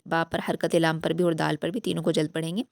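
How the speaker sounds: usual speaking voice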